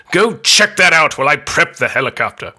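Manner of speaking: a loosely done Charles Dance voice; a lightly voiced stern English villain; Charles Dance impression. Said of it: "not really a great Charles Dance impression".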